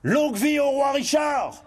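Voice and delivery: high rallying shout; rally the crowd with a full shout. A narrator's reading. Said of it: "Et il hurla".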